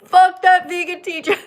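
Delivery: loud, high-pitched mock jeering; hammed-up heckler shout, breaking into a laugh. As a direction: yell it like a crowd of haters, put-on. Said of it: "thousands of people like".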